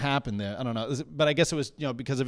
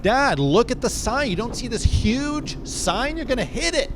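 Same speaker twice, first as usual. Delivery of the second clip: loud, high-pitched panicked warning; yelling at dad; 9 semitones higher, 6 dB louder than usual. Retold or imitated. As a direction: yell it high and urgent at your father. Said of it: "I yell at him".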